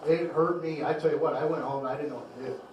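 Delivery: ordinary talk, nothing marked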